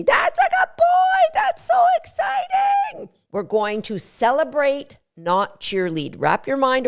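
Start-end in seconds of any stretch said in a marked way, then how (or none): 0.0-3.1 very high, loud praise for the dog; celebrating. Shown as a demonstration. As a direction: burst out in delighted celebration. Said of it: "you can go crazy".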